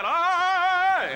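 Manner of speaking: high loud mock scream; strangled scream. Someone strangling dad, a shrill mock scream. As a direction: cry out like someone being choked.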